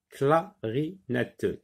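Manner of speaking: "clarinette" slowly split as cla-ri-net-te; syllable by syllable. Shown as a demonstration. Teacher locates the sound for pupils, "clarinette" said syllable by syllable. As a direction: separate each syllable of "clarinette". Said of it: "quatre syllabes".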